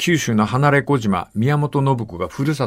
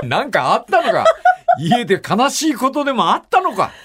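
laughing while teasing, the first laugh much higher; real laughter running through playful questions; laughing tease; 9 semitones higher than usual. Real, not acted. The host laughs as he teases his co-host about home.

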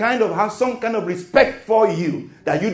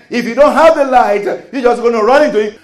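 louder, laughing, almost calling out; laughing exclamation; 5 semitones higher, 9 dB louder than usual. Real, not acted. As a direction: burst out with an amused warning. Rising into a loud, laughing exclamation.

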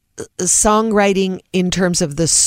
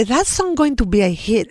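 Greek mom, slightly raised pitch; Greek mother's accent; 4 semitones higher than usual. Retold or imitated. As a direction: quote mom in her Greek-accented English. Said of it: "And it sounds just like that".

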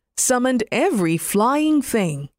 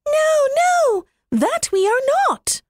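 passionate little wrens. Acted. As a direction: squeak out a furious protest like tiny birds. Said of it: "burst out the little wrens together in a great passion".

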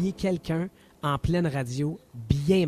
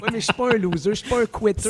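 higher, louder, laughing protest; indignant protest; 6 semitones higher, 5 dB louder than usual. Real, not acted. Host protests to Alex, louder and higher through laughter. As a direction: insist you're no loser, half laughing. Defending himself to co-hosts, laughing.

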